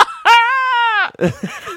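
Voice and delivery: high, shouting laugh. Real, not acted. Cracking up at her own threat, a high shouted laugh.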